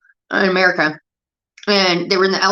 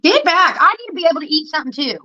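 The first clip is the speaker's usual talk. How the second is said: sharp high shout at the dog; 9 semitones higher than usual. Real, not acted. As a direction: snap at the dog to back off from the food. Shooing the dog off her food.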